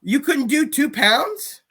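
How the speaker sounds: high, slow disbelieving question; can't believe he missed it; drawn-out incredulous rise. Real, not acted. Incredulous at missing weight.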